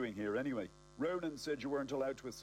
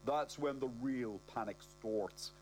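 ordinary delivery, nothing marked

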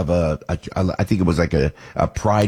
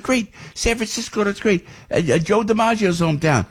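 higher mock enthusiasm; 12 semitones higher than usual. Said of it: "I said".

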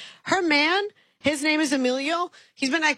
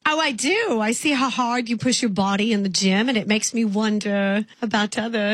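lightly put-on English accent calling a stranger "love"; sweet English caller, accent worn lightly; British accent; 2 semitones lower than usual. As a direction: play a chatty Englishwoman with a mild accent. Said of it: "I'm from England".

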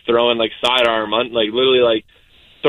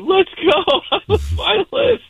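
high-pitched mock scream, little extra volume; imitating your own excited scream, much higher; retold excited scream; slower than usual. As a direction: squeal it like you just won. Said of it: "I scream, like".

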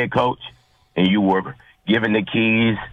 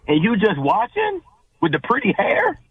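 high-pitched, incredulous questioning; bewildered; 13 semitones higher than usual. Real, not acted. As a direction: ask in bewildered disbelief, pitch way up. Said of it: "I'm bewildered".

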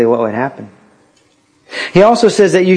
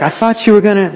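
friend teasing; 7 semitones higher, faster than usual. Retold or imitated. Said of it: "the friend's like".

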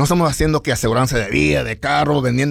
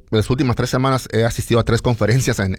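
normal talking voice

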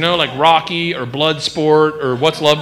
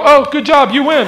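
mock cheer; 8 semitones higher, 4 dB louder than usual. Imagined bystanders.

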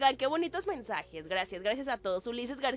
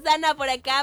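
ordinary talk

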